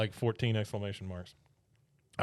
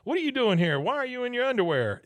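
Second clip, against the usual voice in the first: much higher, louder mock alarm; startled Hank; 11 semitones higher, 5 dB louder than usual. Hank caught off guard by Debbie in his truck, mock startled.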